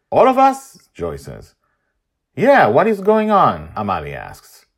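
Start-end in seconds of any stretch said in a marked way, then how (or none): 0.1-0.8 put-on girl's voice. Daughter voiced. 2.3-3.7 put-on girl's voice. Amalie voiced.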